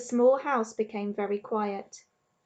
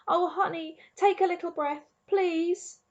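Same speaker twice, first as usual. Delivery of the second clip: pleading; 7 semitones higher than usual. A narrator's reading. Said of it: "said Bryce".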